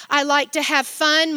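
usual speaking voice

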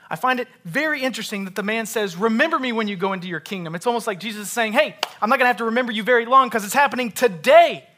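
loud, high, fast preacher delivery; full-force preaching. Real, not acted. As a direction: drive the point home loudly and quickly.

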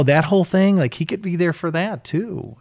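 usual speaking voice

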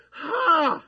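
a sudden high, loud "Ha!"; sharp high laugh. Real, not acted. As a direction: bark one laugh of disbelief. Preacher reacts to dreams.

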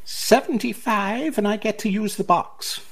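name your price in a high character voice; haggling seller. Acted. Game master as seller haggling over the box, high voice.

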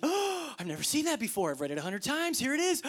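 high excited exclamation; excited discovery. Pretend. Reader finding a new idea in Scripture, excited. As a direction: burst out with delighted surprise. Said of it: "It's like".

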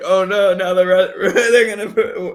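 high, loud hammed-up alarm with a laugh breaking in; mock panic. The West portraying the Ukrainian president's remark as a threat, hammed-up panic. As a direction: play up fake fear for laughs, high and loud. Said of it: "portrays it like".

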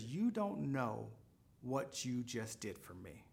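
slow, soft, heartfelt thanks; softly moved. Retold or imitated. Retold crying cashier thanking the shopper, softly moved.

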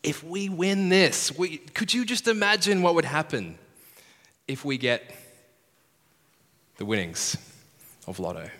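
giddy parents picturing a lotto win; excited parents imagining. Retold or imitated. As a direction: dream out loud about winning big. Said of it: "they were like".

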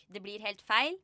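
ordinary delivery, nothing marked

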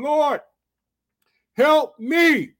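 high, loud plea with a long pause; crying out. Acted. Woman worshiping Jesus begs for help, crying out. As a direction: cry it out high and loud, word by word. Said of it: "Don't be afraid to cry out to God".

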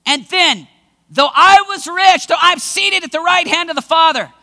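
normal talking voice

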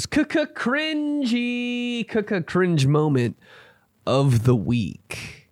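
slow, drawn-out stuttered announcement; mock segment jingle. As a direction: start high and stretched, then step down.

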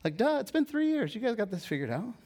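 high-pitched "duh" assurance; breezy confidence. Retold or imitated. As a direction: act out someone cheerfully sure they already know. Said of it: "Jesus says so confident".

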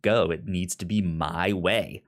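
normal talking voice

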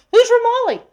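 high, loud scoffing question; mocking scorn. God scoffs at the son of Ramali, mocking. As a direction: scoff at the nobody king.

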